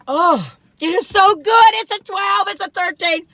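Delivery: high, slow, gushing delight; excited raving. Real, not acted. She rates the cookie to viewers, high and thrilled. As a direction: gush about the taste.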